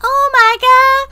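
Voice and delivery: high, slow, shocked Oh my God; drawn-out disbelief; shocked gasp. Real, not acted. Reacting to news article.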